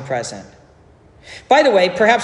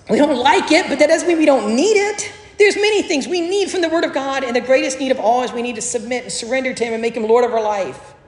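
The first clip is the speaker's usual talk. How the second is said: raised pulpit voice, higher; preaching at full force; 7 semitones higher than usual. Acted. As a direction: preach it out to the congregation.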